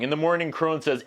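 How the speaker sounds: normal talking voice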